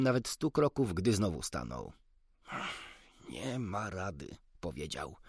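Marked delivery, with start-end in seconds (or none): 3.3-4.4 resigned doctor, soft and slow; a hint of the doctor giving in; lightly voiced doctor. A narrator's reading. Doctor sighing over a bird shop, lightly resigned.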